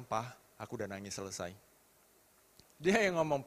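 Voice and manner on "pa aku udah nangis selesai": small subdued voice. Retold or imitated. Child reports done crying.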